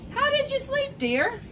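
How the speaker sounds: queen sweetly asking the princess how she slept. Acted. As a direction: pitch way up for the queen's question. Queen asks again.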